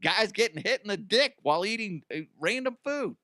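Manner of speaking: high, mock-amused voice of a jobless viewer; high goofy impression of someone laughing at dumb videos; mocking unemployed viewer. Jobless viewer mocking himself at home, high goofy voice. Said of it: "And then they're like".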